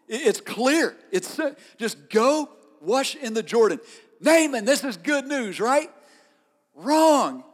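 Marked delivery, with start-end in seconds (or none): 4.2-5.9 rising teasing excitement. Acted. Preacher builds irony. 6.8-7.4 "Wrong." thrown out high and loud; emphatic loud "Wrong."; loud punchline. Acted. Punchline to good news.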